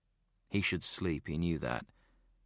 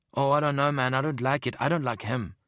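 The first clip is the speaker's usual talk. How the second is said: high, loud, uneasy protest; worried South African; 7 semitones higher, 4 dB louder than usual. A narrator's reading. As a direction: fret out loud. Worried partner objects.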